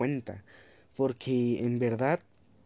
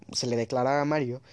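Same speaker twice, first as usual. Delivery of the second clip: usual speaking voice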